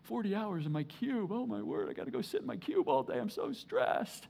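soft, put-on complaining; mock office whiner. Preacher mocking men whining about desk jobs, soft and whiny. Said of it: "we're sitting around going".

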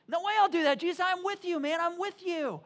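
high, loud, insistent pledge; vehement protest. Acted. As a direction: protest loyalty with acted fervour. Acted Peter protests his loyalty.

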